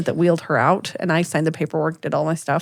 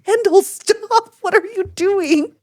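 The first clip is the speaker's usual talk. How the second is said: high, slow, mortified reproach; retold horrified scolding; 11 semitones higher, slower than usual. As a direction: drag out each word, mock aghast. Retold scolding after shouted remark.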